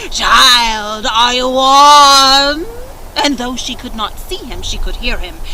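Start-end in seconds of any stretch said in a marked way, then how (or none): 0.1-2.7 put-on very slow, louder old spirit; Grandfather Frost calling. A narrator's reading. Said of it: "he cried out".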